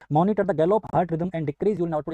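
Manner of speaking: slow, deliberate exam-question reading. Shown as a demonstration. Teacher to students reading the quiz, slowly and deliberately. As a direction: read each word out slowly for the class. Reads answer option aloud.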